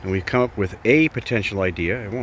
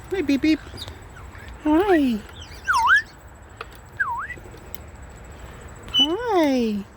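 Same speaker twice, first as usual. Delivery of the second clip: pet talk; much slower than usual. Real, not acted. Greeting the bird Beep.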